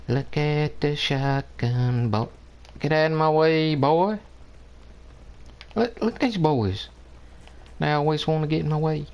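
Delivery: hammed-up tough gamer voice, playful; silly put-on voice. A hint of a goofy voice while pushing past teammates. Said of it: "Now I'm still talking like this".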